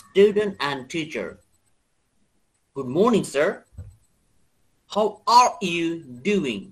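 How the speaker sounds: slow, deliberate American English; American accent. Shown as a demonstration. Teacher reads a dialogue for students, American accent. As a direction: read it with American r's. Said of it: "এখন American অ্যাকসেন্টে পড়বো".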